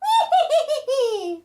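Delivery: high put-on witch cackle. The witch laughing aloud in the wind, a high cackle. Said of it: "The witch laughed aloud".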